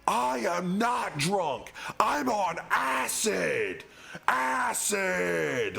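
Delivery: high, slow, drawn-out indignant shout; insistent yelling. Retold or imitated. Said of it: "yelling at me".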